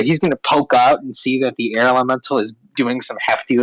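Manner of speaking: ordinary delivery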